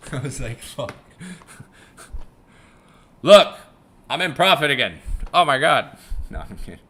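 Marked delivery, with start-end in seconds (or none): none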